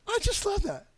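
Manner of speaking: high, sarcastic gush; high mock enthusiasm; mock delight. Real, not acted. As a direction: gush over the excuse ironically. Sarcastic delight at gene excuse.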